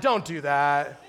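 normal talking voice

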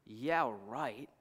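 low, soft, doubting aside; sceptical disbelief. Retold or imitated. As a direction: dismiss it under your voice.

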